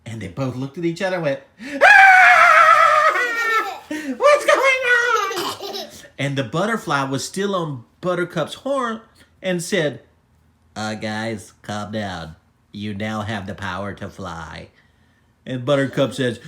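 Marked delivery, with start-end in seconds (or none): 1.8-5.9 high, loud, drawn-out cartoon panic; scream, then wail the question; panicked scream. Both unicorns floating in air, hammed-up panic. 10.8-14.7 low, steady calming voice; calm butterfly. Acted. Butterfly calming the screaming unicorns, low calm voice.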